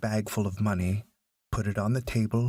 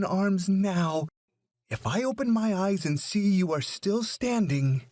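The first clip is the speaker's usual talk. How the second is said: high, sharp scolding woman's voice; scolding mother; 9 semitones higher than usual. A narrator's reading. Mother scolding her son in front of the principal, high and sharp. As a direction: berate the boy, voice pitched high.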